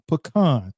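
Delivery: PECAN said puh-KAHN; Pecan with a weak first syllable, then KAHN; local pronunciation. Shown as a demonstration. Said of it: "We say pecan".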